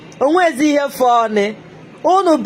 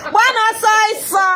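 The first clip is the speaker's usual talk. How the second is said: higher and fast, gloating; gleeful goading; 7 semitones higher, much faster than usual. Acted. Host urging opponents to run, fast gleeful taunt. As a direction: rush the jeer out with glee.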